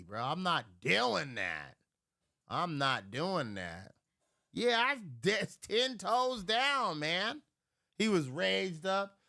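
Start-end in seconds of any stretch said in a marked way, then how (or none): none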